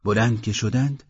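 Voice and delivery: ordinary delivery, nothing marked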